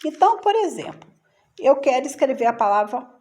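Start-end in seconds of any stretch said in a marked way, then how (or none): none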